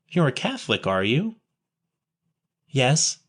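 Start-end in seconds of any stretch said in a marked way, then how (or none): none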